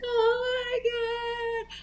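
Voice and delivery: high, very slow oh my God; a kid's dread; drawn-out despair. Retold or imitated. Kid despairing before throwing the present in the fire.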